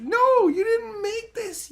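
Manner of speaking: very high, drawn-out indignant shout; high, slow, exasperated correction; exasperated yell. Acted. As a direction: cry it out slowly in outrage. Acted retort to the upset people.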